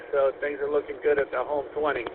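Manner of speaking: ordinary talk, nothing marked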